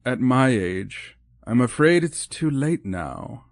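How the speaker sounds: slow, wistful resignation; slow, a touch of trembling sadness; trembling, sad. Acted. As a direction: let the voice sink into regret, slowly. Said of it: "Her voice trembled".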